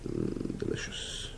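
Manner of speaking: breathy whispered delight. Real, not acted. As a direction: whisper it to yourself. Glue bottle finally opened.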